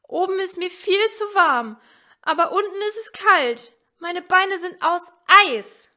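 put-on high, loud complaining child's voice; a child stuck and miserable, complaining loudly; little girl Elsie. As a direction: whine it out like a freezing little girl. Elsie's voice in the story.

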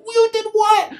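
high, shocked question; police freaking out. Retold or imitated. Police hearing Calvin confess, high-pitched shock. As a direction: squeal it in disbelief. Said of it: "the police are freaking out".

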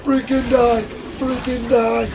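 frantic yelling. Real, not acted. Shooting at monster.

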